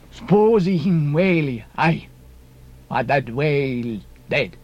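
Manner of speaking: put-on slow, broken-English foreign accent; deliberate accented harpooneer; Queequeg. A narrator's reading. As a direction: draw out the accented words plainly. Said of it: "said Queequeg".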